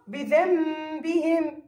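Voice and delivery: بذنبهم slowed, with a long nasal m before the ب; iqlab with ghunna. Shown as a demonstration. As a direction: turn the ن of بذنبهم into m and hold it two counts. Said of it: "كيف ألفظها؟".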